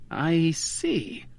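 softly tentative, a little drawn up. A narrator's reading. Answering the wife about the bonds, tentatively. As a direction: feel your way into it, softly. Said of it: "I said tentatively".

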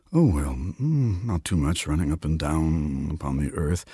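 hesitant, understated figure of Death; lightly voiced, unhurried Death; diffident Death. A narrator's reading. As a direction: drag out the opening, then play it down.

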